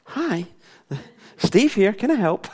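put-on pleasant phone manner; sweet phone voice. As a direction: answer sweetly as if all is calm. Sudden composed phone answer after shout.

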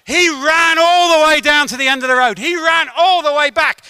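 high, loud, triumphant storytelling; emphatic preaching. Real, not acted. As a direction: drive the story home from the pulpit.

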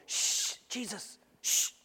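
whispered hushing of Jesus; shushing Pharisees. Acted. Pharisees hushing Jesus, whispered. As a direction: hush him in a whisper. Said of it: "they have to quiet Jesus".

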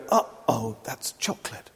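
hushed, low uh-oh to oneself; softly acted wary self-warning; alarmed realisation. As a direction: drop to a near whisper as it dawns. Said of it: "the alarm bell goes off".

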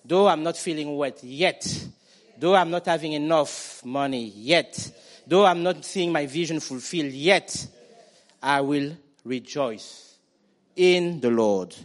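loud, slow declaration of faith; full-force preaching. Acted. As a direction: proclaim it to the congregation.

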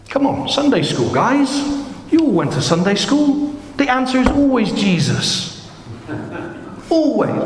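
high, incredulous teasing of the congregation; playful exasperation. Real, not acted. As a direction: chide the Sunday school class in a high voice. Playfully chiding congregation with Sunday-school answer.

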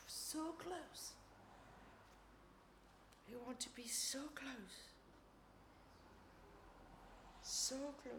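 soft, lingering repetition; intimate, reflective murmur; hushed yearning prayer. Real, not acted. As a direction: dwell on it quietly in prayer. Lingering prayer during a pause.

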